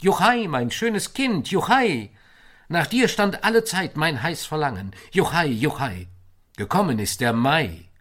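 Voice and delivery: loud high whoops of joy; joyful shout. Acted. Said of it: "und rufst". (lauter, jubelnder Ruf)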